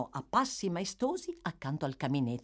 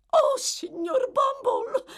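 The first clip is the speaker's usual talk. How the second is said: very high, wailing female character cry; 6 dB louder, slower than usual. A narrator's reading. Matron calling to Bumble from the armchair, crying out. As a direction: cry out shrilly in theatrical distress. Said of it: "gridò la dama".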